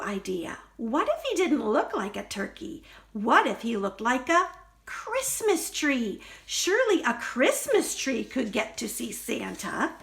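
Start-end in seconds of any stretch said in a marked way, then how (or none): none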